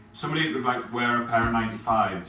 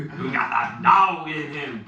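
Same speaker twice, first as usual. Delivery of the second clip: put-on slow, drawn-out American accent, louder; mock American accent; 5 semitones higher, 5 dB louder, slower than usual. Teasing his friend with an American accent, slow. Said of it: "why are you doing American accents?".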